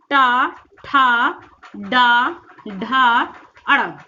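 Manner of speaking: टा, ठा, डा, ढा very slow, vowels held; articulation demo. Shown as a demonstration. Teacher showing the टा वर्ग, टा, ठा, डा, ढा drawn out. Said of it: "टा वर्ग में जो भी आपका होता है".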